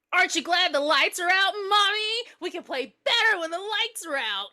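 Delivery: high, loud playful menace; gleeful creepy little boy; taunting child. Acted. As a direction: tease mother in a high kid voice. Child taunting mother in the dark.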